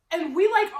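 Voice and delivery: ordinary talk, nothing marked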